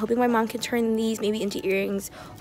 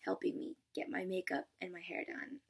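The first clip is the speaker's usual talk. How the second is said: whispering; 3 semitones lower, 12 dB quieter than usual. Real, not acted. Unvoiced whisper over photo.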